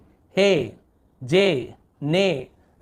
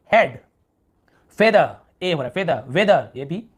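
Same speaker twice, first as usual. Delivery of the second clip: usual speaking voice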